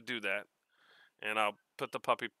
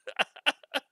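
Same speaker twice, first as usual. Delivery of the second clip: short, much higher giggle; high laugh; 14 semitones higher than usual. Real, not acted. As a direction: giggle mid-letter. Laughing while reading letter.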